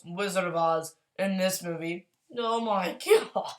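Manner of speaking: ordinary talk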